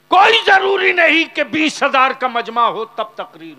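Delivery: booming pulpit call; fiery preaching. Acted. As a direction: hit it at full force from the pulpit. Preacher's sudden fiery outburst.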